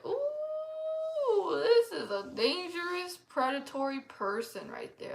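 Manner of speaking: sounding the danger she felt; alarmed realisation. Retold or imitated. Realising he is a predator.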